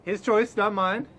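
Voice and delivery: ordinary talk, nothing marked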